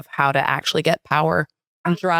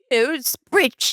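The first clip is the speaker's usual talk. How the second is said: put-on British accent; 8 semitones higher than usual. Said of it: "where it's like".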